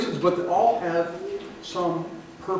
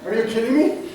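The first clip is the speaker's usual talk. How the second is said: higher, louder incredulous question; mock terrified disbelief; 6 semitones higher, 6 dB louder than usual. Traveler reacts to the stranger in the next stall, mock terrified. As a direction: act out alarmed disbelief. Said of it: "I'm terrified".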